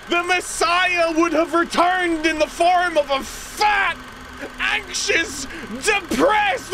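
high, drawn-out showman's proclamation; triumphant Jesus, grand announcement. Pretend. Self-styled Messiah announcing his return, triumphant proclamation. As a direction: proclaim it high and slow, milking every phrase.

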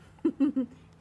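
short real laugh; amused laugh. Real, not acted. Teacher laughing at the page, amused chuckle. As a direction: laugh at the picture.

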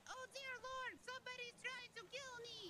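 high-pitched mock panic, drawn out at the end; mock cry for help. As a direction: pretend to scream for your life. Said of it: "The only time you're gonna yell".

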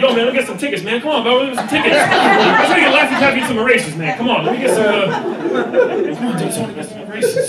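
high, loud pleading hustle; begging kids. Retold or imitated. Comedian voicing arcade kids begging him for tickets, high loud pleading. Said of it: "A bunch of crackheads with giant empty buckets".

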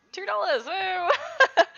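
laughing, high, loud woo over a tiny sale; mock-triumphant whoop; gleeful cheer. Real, not acted. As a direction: celebrate two dollars like a jackpot.

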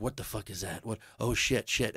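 whispered panic; hushed alarm. Retold or imitated. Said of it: "we're all just like".

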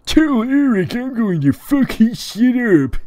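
very high, loud, slow threat; character threat. Acted. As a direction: spit the threat out in the put-on voice. Tyler threatens via Anon.

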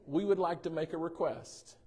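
ordinary delivery

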